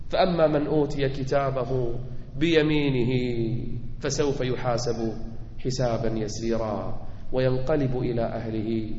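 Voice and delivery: low, soft, hushed recitation; subdued low-pitched prayer; solemn sermon closing. Real, not acted. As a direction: bring it down to a quiet, prayerful close. Closes sermon with verse and prayer.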